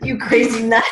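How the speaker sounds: loud laughing jab; laughing tease. Retold or imitated. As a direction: laugh it off as crazy.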